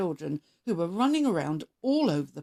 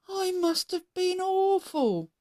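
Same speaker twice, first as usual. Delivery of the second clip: higher, slow, a touch of dismay; mild self-doubting worry; 11 semitones higher than usual. A narrator's reading. Scarecrow after his song, mildly dismayed.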